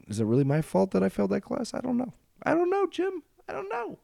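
climbing into a very high comic squeal; hammed-up, high-pitched protest of innocence; mock innocence. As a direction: plead ignorance in an absurdly high voice. Mock shirking blame for failing.

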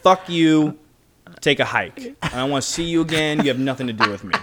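loud, high, angry brush-off; angry dismissal. Retold or imitated. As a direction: snap at him and send him away. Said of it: "I'm like".

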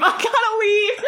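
high, loud, cracking up; laughing shout. Retold or imitated. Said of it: "I have to leave".

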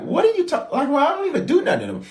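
squeaky high, fast, indignant "what are you talking about"; high incredulous protest; mock disbelief. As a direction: play the wronged innocent, pitch way up. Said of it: "You're like".

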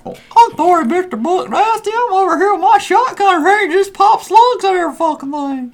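very high, squeaky mock of his buddy; high falsetto caricature of a friend's voice; mocking Cash's voice. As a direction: parody a friend in a silly high voice. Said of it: "that's not how my voice sounds".

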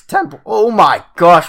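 startled by own cracking voice, pitch jumping up. Real, not acted. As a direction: voice breaks high while hoarse from being sick. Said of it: "my voice".

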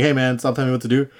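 ordinary delivery

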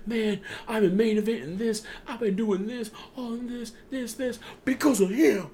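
imitating Jay complaining in the ring; retold Jay rant. Jay finally gets the mic and speaks.

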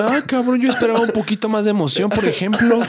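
high, loud let-down whine; hammed-up disappointed friend; mock disappointment. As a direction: sound let down, half joking.

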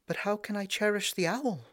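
put-on high, pleading young princess; crying princess. A narrator's reading. Princess to her dying father, high and pleading. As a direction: cry out in bewildered distress. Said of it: "cried the poor princess".

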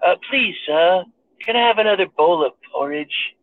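put-on high, timid begging child voice; pleading orphan boy. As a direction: beg meekly like a hungry orphan. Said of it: "Zelensky looks like Oliver Twist".

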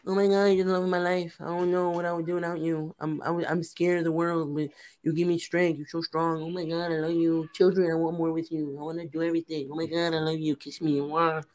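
mock-gushing, breathless run of devotion; gushing proposal spiel. Man on one knee proposing, hammed-up gushing spiel. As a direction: pour it out in an even, unbroken stream. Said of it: "I started hitting the whole spiel like".

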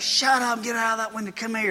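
urgent, held-down mock scolding; hushed scolding mother. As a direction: shush the child away from the window. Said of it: "And she's like".

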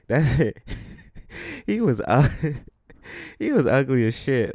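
laughing through the words; cracking up mid-sentence; words breaking into laughter. Real, not acted. Laughs at his own joke.

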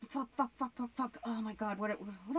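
"fuck" said very softly under the breath; quiet defeated "fuck, fuck"; muttered swearing. Acted. Muttered after no answer.

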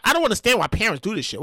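ordinary talk, nothing marked

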